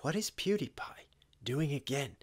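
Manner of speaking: hushed, unvoiced whisper of disbelief; stunned whisper. Real, not acted. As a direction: whisper it in shock. Stunned aside on seeing PewDiePie.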